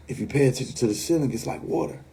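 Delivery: low, soft and trailing into a whisper; creepy hushed warning. Retold or imitated. Friend warns about the ceiling, hushed and eerie. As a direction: spook the listener with it.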